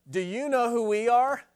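how dare you question us; indignant challenge. Retold or imitated. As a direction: Pharisees snapping back at Jesus, indignant. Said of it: "not "Who are you?" but".

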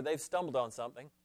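normal talking voice

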